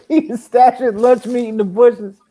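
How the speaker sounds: high-pitched, laughing jibe; laughing tease. Real, not acted. Ribbing a friend who sneaked food, laughing. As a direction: crack up while ribbing a friend. Teasing friend about secret eating.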